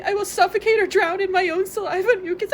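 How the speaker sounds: ordinary talk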